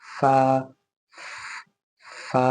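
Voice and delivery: फा, lip under upper teeth, clear f sound; fa demo. Shown as a demonstration. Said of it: "ऊपर के दांतों को वहाँ टच करना है". (फा को निचले होंठ और ऊपर के दांत से बोलो)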